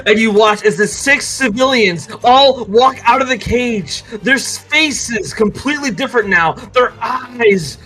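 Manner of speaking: rising, emphatic dungeon master narration; game master's scene setting. Acted. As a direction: build the horror, stretching each detail.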